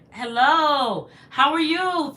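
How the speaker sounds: high, loud hello; loud high hello to a viewer; loud greeting. Real, not acted. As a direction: call out a warm welcome.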